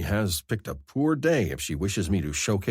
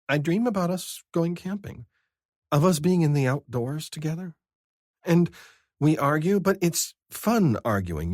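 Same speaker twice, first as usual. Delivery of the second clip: higher, louder fond woman's voice; Vivi's voice; 11 semitones higher, 4 dB louder than usual. A narrator's reading. Vivi telling Scar about her dream father, fond and higher. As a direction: share it with a fond lilt.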